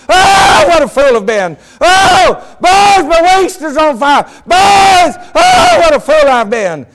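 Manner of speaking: high, very loud anguished screams; a dying man shrieking his legs are on fire; preacher reenacting a father's death screams; screaming in terror. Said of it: "he was screaming".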